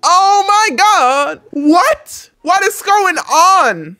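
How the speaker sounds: high, loud, drawn-out disbelief; shocked outcry. Acted. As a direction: cry out slowly in shock. Character sees his girlfriend cheating.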